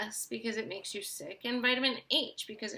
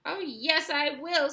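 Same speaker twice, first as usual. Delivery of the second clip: high little squeaky voice; tiny squeak; 6 semitones higher, 5 dB louder, slower than usual. A narrator's reading. Tiny boy squeaks a defiant reply to his mother. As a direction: squeak back cheekily. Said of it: "squeaked the tiny little voice".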